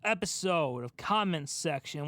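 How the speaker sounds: ordinary delivery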